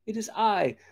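high-pitched goat reply; high voice of a smallish goat; middle billy goat. A narrator's reading. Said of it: "said the goat".

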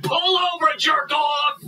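drawn-out start rising to a high yell; angry command. Acted. Cop spots the driver who wronged him, angry. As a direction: order the car over furiously.

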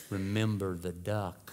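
low, slow, soft threat; softly menacing sister reminder; quiet warning. Retold or imitated. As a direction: lean in and murmur it slyly. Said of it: "she leaned over and she said".

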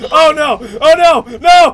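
loud high no, no; rising panicked cry. Real, not acted. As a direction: cry out as doom nears. About to die together.

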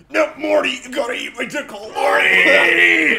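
loud, shouted cartoon scientist; Rick impression. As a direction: bellow it like Rick yelling. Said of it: "Wow, Rick and Morty".

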